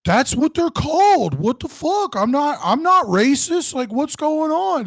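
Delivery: high, slow, put-on voice of an indignant recruit; imitating a defensive army buddy. Mocking the Alaska recruit's protest to a cohost, high and slow. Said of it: "and he was like".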